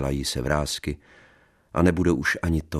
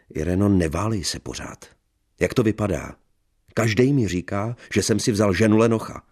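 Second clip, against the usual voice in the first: loud, high scolding tirade; angry scolding; 5 semitones higher, 5 dB louder than usual. Acted. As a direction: lay into her. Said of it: "Postavil se nad ní a spustil". (rozčilené hubování)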